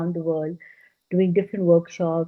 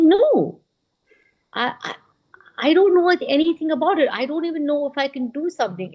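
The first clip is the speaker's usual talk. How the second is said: higher, flustered protest; flustered refusal; 8 semitones higher than usual. Retold or imitated. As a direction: push it away, a little panicked and higher.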